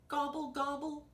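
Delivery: high gobbling turkey, lightly grumbled; grumbling turkey. A narrator's reading. Turkey told he is too short, high mock-grumbled gobble. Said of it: "grumbled Turkey".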